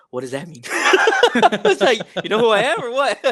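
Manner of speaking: retold starstruck question, giggly and high; laughing disbelief. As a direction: pitch jumping high through laughter.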